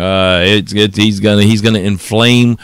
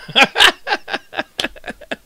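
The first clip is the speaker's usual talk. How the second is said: high cackling laugh; 4 dB quieter than usual. Real, not acted. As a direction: burst out laughing at the tweet. Laughs at the tweet he read.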